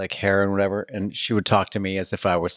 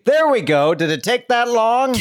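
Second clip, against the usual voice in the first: raised, high sarcastic triumph; loud exasperation; 5 dB louder than usual. Retold or imitated. Man finally gets dancer's real name, loud exasperation.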